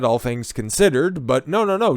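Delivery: normal talking voice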